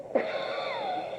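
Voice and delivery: helpless giggle; high squealing laugh. Real, not acted. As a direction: burst of high-pitched laughter. Laughing at own story.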